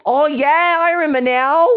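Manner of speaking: high, loud burst of delight; excited recognition. Acted. Serena suddenly remembers the boy, loud excited cry. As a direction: exclaim as it suddenly comes back.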